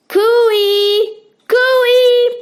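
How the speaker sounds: long high loud call across the bush; yelled cooey. A narrator's reading. Family calls for help at the tops of voices. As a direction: call out to be found.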